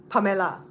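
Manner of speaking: "Pamela" as pa-me-la with a clear e; Belizean Pamela. Shown as a demonstration. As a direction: stress the e in "Pamela". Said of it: "There's an E in there".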